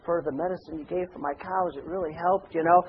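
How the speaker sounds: very soft, low, timid farmer; mealy-mouthed little voice. Acted. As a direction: mumble meek thanks, barely audible. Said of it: "mealy-mouthed little voice".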